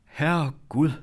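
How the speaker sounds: high, loud cry of astonishment; startled exclamation. A narrator's reading. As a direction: exclaim in sudden recognition. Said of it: "råbte hun og slog hænderne sammen". (forbløffet udråb)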